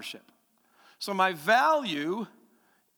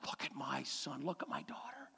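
whispered, hushed in wonder; awed whisper; 5 semitones lower, 15 dB quieter than usual. Retold or imitated. New parent whispering over their baby, hushed. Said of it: "You're like".

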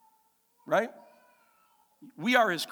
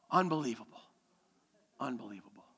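awed; 7 semitones lower, 6 dB quieter, faster than usual. Retold or imitated. Parent marveling at newborn.

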